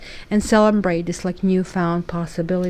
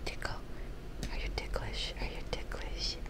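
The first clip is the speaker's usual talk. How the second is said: whispered to the cat; hushed whisper praising the cat; 15 dB quieter than usual. Real, not acted.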